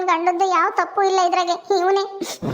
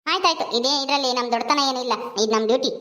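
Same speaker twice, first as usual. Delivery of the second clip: lower, brisk police officer voice; an officer giving quick orders; stern policewoman; 6 semitones lower than usual. Acted. Policewoman role held. (ಗಡುಸಾದ police ಅಧಿಕಾರಿಯ ಧ್ವನಿ)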